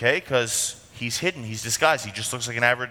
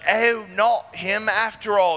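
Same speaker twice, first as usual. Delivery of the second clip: higher, drawn-out call to the troops; mock calling out; 7 semitones higher, slower than usual. Attackers realizing they have the wrong king, mock calling out. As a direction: call it out slowly, pitched up.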